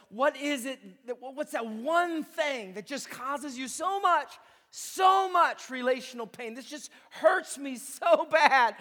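loud, impassioned preaching. Acted. Pastor pushing the church to name their pain, impassioned. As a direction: press hard on the pain.

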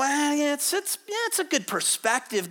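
offhand, hesitant mock approval; mock indifference. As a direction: imitate someone mildly unimpressed. Acting out a lukewarm reader's reaction.

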